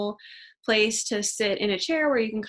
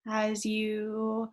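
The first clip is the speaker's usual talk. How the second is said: gentle yoga nidra guidance, quieter at phrase ends; guided meditation; 4 dB quieter than usual. Acted. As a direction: ease them into relaxation, unhurried and soft. Leads yoga nidra relaxation.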